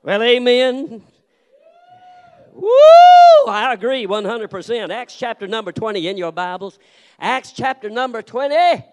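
rally the congregation from the pulpit; full-force preaching. Acted. Preacher calling the church to open their Bibles, loud and ringing.

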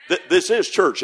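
ordinary talk

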